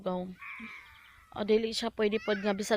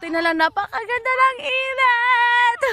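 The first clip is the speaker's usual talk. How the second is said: much higher, louder gushing cry; excited exclamation; 12 semitones higher, 8 dB louder than usual. Real, not acted. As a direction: gush over the view.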